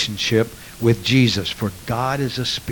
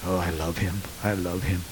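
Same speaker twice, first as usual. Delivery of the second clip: softer, lower, sighing "Oh, I love him"; tender heartfelt love; 5 semitones lower, 6 dB quieter than usual. Retold or imitated. Preacher shows emotional love for Jesus, soft and tender. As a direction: breathe it out like a true lover of God. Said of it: "It's that sharing of emotions".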